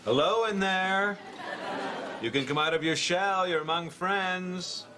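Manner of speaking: high, singsong coaxing; mock coaxing. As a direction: coax them out as if calling into a shell. Coaxing a withdrawn colleague out.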